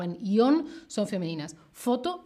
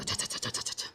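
rapid whispered cha-cha keyboard clatter; imitated typing noise; 10 dB quieter, much faster than usual. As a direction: imitate busy typing with soft, voiceless syllables. Mimes typing at the computer.

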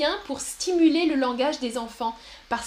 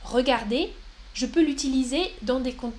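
ordinary talk, nothing marked

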